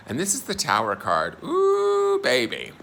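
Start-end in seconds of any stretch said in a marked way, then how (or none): 1.4-2.7 high-pitched ooh of shock; surprised. Real, not acted. Shocked at drawing the Tower.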